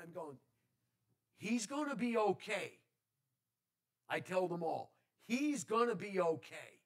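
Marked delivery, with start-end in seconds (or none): 1.4-2.7 higher and louder, certain; firm reassurance. Retold or imitated. Assures his worried family. 5.3-6.8 higher and louder, certain; firm reassurance. Retold or imitated. Repeats assurance to family.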